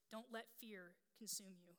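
ordinary talk

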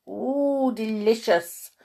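a hint of a contented bee; bumbling bee. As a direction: savour it with a drawn-out "ooh". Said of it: "bumbled Buzz".

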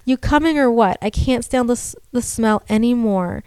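steady storytelling read; story narration. A narrator's reading. Reads horror story aloud.

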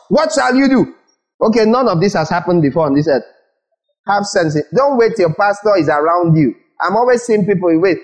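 ordinary delivery

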